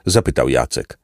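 ordinary delivery

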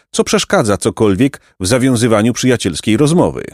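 ordinary delivery

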